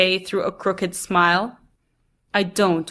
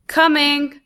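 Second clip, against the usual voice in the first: calling back; 7 semitones higher, 4 dB louder than usual. A narrator's reading. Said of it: "Grandma says".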